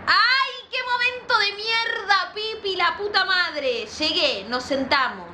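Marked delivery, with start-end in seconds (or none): 0.1-3.9 much higher, slow and drawn-out; dramatic dismay. Real, not acted. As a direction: wince at the awful moment. Reacting to the awkward meeting.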